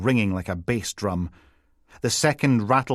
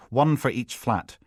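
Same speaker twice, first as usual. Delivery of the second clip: plain reading voice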